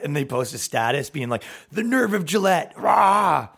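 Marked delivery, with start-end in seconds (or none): 1.7-3.5 hammed-up fury ending in a roar; mock outrage. Person posting a status against Gillette, mock outraged roar. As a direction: build indignantly to a mock growly shout.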